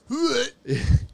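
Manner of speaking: high, loud imitated yelps; imitated little boy noise. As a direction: mimic a small boy's repeated squeal. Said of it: "a little boy was going".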